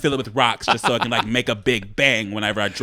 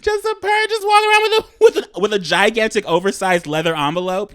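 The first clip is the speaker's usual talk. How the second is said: high, disbelieving mock voice; incredulous high-pitched mockery; 7 semitones higher, 4 dB louder than usual. Mocking the bag idea.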